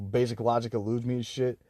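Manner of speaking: ordinary talk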